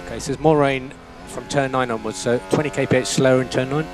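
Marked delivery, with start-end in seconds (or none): none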